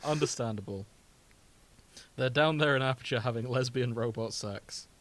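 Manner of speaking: ordinary talk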